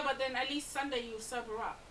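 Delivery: ordinary talk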